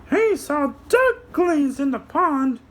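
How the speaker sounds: high squeaky puppet, slow; a little puppet explaining to kids; high cartoon helper voice. Puppet answers.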